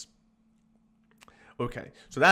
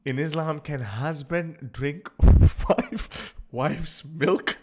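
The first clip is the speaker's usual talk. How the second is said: mock search query; 3 semitones higher, 7 dB louder, much slower than usual. Reading an absurd search to viewers, laughing. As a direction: read out through breaking laughter.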